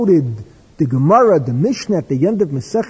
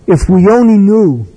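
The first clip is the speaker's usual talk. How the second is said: louder, a wailing regret over the news; wistful lament; 2 semitones higher, 8 dB louder than usual. Retold or imitated. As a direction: mourn it, loud and grieving.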